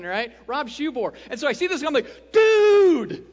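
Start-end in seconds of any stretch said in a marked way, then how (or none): 2.3-3.2 high, loud delighted shout of greeting; excited dude. Retold or imitated. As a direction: greet an old friend with a burst of joy. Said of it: "that dude means I'm excited".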